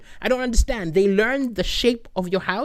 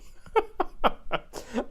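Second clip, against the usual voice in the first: breathy laugh; 6 semitones higher, 6 dB quieter than usual. Real, not acted. Laughs at his own bit.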